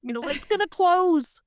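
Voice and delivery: high, thrilled call across the room; calling to her training partner to go buy the puppy, high and elated; excited yelling. Retold or imitated. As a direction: pitch it way up, overjoyed and urgent. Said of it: "I'm yelling to my training partner".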